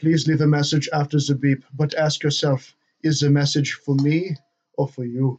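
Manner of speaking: put-on slow, solemn German accent; Werner Herzog impression. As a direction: read it like a brooding German filmmaker. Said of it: "Werner Herzog's voicemail".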